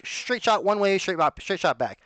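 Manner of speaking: normal talking voice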